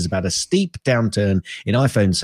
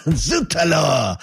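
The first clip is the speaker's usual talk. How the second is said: drawn-out mock French exclamation; slow French exclamation; 4 semitones higher, much slower than usual. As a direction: savour it slowly like a cartoon Frenchman. Repeats French exclamation slowly.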